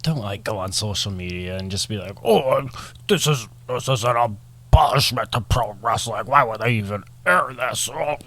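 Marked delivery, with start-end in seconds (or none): 2.2-8.2 slow, drawn-out blustering impression; outraged impression, dragging out the words; Vince McMahon. Mocking social media complainers about pro wrestling, blustering impression. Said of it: "That was Vince McMahon".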